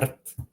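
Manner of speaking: "Rt" with only a trilled R and a T; no vowel in "Rt", just roll and stop; syllabic rolled R. Shown as a demonstration. Teacher asking students to repeat, vowelless trilled "Rt". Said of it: "Try pronouncing that".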